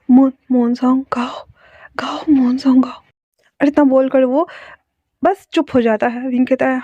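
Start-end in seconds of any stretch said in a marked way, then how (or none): 0.1-3.0 breathless whispered plea; urgent whisper. Acted. Wounded wolf begs caller for moon song, whisper. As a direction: whisper it, desperate and weak.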